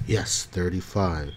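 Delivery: ordinary delivery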